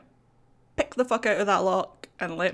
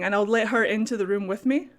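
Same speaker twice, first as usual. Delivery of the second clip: ordinary talk, nothing marked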